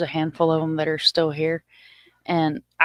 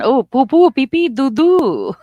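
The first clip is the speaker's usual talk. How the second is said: higher, louder silly babble; mock French gibberish; 7 semitones higher, 7 dB louder than usual. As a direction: ham up fake French.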